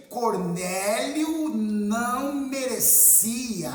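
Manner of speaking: very slow, stretched syllables; drawn-out emphasis. Retold or imitated. Preacher reporting Peter's snub of Cornélio, very drawn-out emphasis. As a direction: draw out "não merecia" syllable by syllable.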